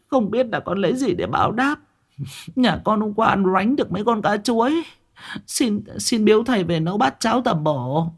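fawning thanks. Acted. Hậu thanks the master.

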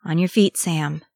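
ordinary delivery, nothing marked

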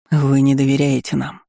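plain reading voice

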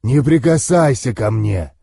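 growled dragon voice. A narrator's reading. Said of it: "прорычал дракон".

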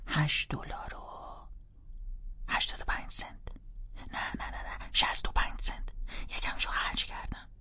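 little sister offering, hushed; whisper. Acted.

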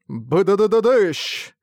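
vocal boom sound effect; explosion noise. Acted. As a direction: mouth a big blast.

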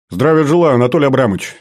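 pleased, lifted salute; delighted greeting. Acted. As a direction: greet an old acquaintance with open delight. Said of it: "обрадовался я".

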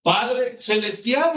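high, loud, drawn-out call; crying out. Acted. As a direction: call out slowly to heaven, acted. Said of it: "¿qué hubiera clamado?". (clamor alto y alargado)

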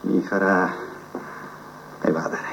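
softly, to himself, "Mi farà evadere" in a low voice; quiet musing. Acted. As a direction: murmur the idea while turning it over. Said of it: "repeated, then whispered".

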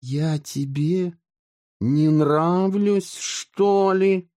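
sulky, let down; disappointed. A narrator's reading. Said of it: "разочарованно спросила Мичи".

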